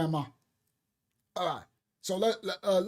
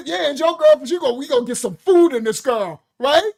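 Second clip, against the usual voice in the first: loud, higher grandma; 3 semitones higher, 9 dB louder than usual. Retold or imitated. Grandma insists on feeding the girl, loud and bossy.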